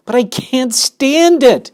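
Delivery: high, loud, slow anguished shout. Acted. Pig refuses to leave the spider, loud anguished shout. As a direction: cry it out in despair. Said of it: "shouted Wilbur".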